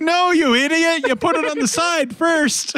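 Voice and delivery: high-pitched mock scolding of a careless grandson; exasperated scolding. Grandfather to grandson after the spark plug shock, exasperated scolding.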